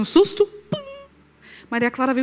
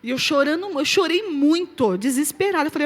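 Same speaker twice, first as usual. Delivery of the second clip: ordinary delivery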